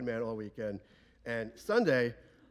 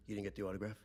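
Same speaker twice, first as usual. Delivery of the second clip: low, soft, stunned question; quiet disbelief; 4 semitones lower, 7 dB quieter, faster than usual. Retold or imitated. Father questioning shaken Uncle Frank, quietly incredulous. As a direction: imitate a father asking in muted disbelief.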